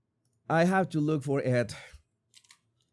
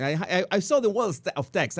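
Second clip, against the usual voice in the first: normal talking voice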